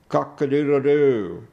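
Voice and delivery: loud, high crowing cock-a-doodle-doo; rooster crow. Acted. Poet voicing the rooster in the play, crowing loudly.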